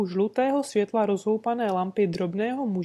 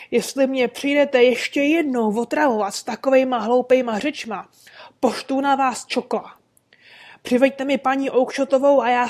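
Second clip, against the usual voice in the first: loud, higher angry rant from the doorway; furious shouting; 4 semitones higher, 6 dB louder than usual. Acted. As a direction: shake your fist and yell him away. Angry stallkeeper drives man off.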